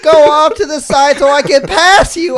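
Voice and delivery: loud, high whining complaint; high, drawn-out mock protest; mock indignant yell. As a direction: imitate her hollering her excuses at a pedestrian. Acting out her yelled complaint.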